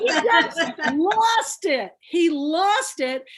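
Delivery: retelling through laughter; cracking up at the memory; laughing. Real, not acted. Laughing recalling host.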